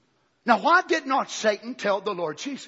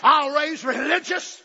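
slow, louder menacing vow; devil's threat; 7 semitones higher, 6 dB louder, slower than usual. Retold or imitated. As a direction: press each word of the threat. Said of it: "he turns the threat on him".

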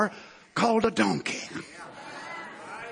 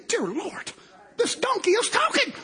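mock astonishment; 10 semitones higher than usual. Preacher voicing how Balaam should react to talking donkey, astonished. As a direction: act dumbfounded, pitch shooting up. Acting amazement Balaam never showed.

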